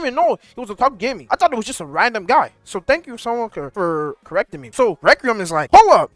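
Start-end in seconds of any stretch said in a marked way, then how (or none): 5.7-6.1 much higher, loud double take; hammed-up gasp of wait, it's him; mock shocked recognition. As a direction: narrator voicing Requiem spotting the scammer. Requiem recognising the scammer.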